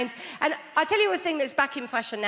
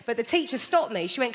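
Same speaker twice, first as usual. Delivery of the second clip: usual speaking voice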